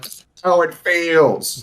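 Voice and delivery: high, drawn-out mock cry; imitating a vindicated villain, slow and high; Loki gloating. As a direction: stretch it out as a gleeful movie quote. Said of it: "when Loki watched Thor get slammed".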